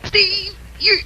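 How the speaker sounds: high, sobbing cry of pain. Acted. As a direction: sob it, pleading with him to stop. Steve hurting Adrian during interrogation.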